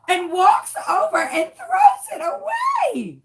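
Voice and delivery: high, wailing comic outrage; aghast disbelief. Real, not acted. Telling friends what waitress did, aghast. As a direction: climb into a high wail of disbelief.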